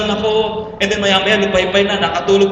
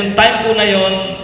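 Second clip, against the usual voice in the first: usual speaking voice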